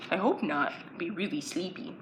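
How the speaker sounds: ordinary talk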